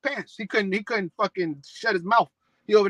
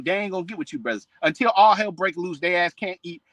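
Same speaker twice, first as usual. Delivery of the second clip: ordinary talk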